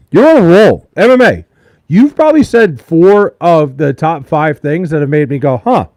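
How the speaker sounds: loud, emphatic encouragement; excited reassurance. Real, not acted. Streamer telling a chatter he is killing it, loudly excited. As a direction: hype up the viewer.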